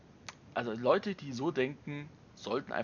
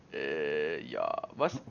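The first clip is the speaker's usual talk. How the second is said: low, confused "äh, ja, was"; bewildered, deep mumble; baffled thought; 9 semitones lower, much slower than usual. Retold or imitated. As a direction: play the stunned newcomer, voice dropped low. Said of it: "wo du denkst so".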